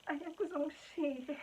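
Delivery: high, slow mock-tearful plea; hammed-up whimper; childish whine. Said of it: "I was so childish about the whole thing".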